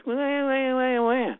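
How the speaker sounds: high, drawn-out mock whine; whining. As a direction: imitate a whiny crybaby wail. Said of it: "Don't give me that whining voice".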